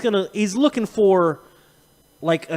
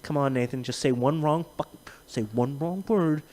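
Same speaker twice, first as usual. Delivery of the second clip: low, soft muttered plea; mock threat under the breath; quietly itching for an excuse; voicing Matt; 6 semitones lower, 7 dB quieter than usual. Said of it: "It's like".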